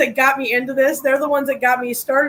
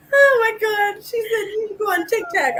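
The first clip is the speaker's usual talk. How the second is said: crying with laughter; 8 semitones higher than usual. Real, not acted. Laughing to tears at co-host.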